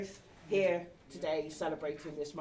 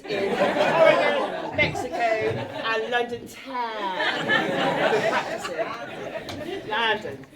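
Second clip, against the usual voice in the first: slow, laugh-filled listing; 4 dB louder, slower than usual. Real, not acted. Teasing the newlyweds about free stays, laughing. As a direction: drag out the list while giggling.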